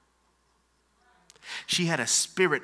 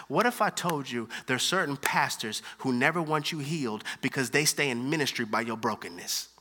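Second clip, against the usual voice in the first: ordinary delivery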